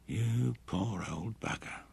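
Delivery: soft, slow whisper; hushed, drawn-out murmur of pity. A narrator's reading. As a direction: whisper it slowly to a sleeping man. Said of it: "whispered Bruce".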